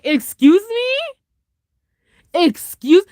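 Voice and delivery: very high, long-drawn exclamation; hammed-up shocked fangirl; mock disbelief. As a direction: squeal it slowly as an incredulous question. Retells shocked delight at film scene.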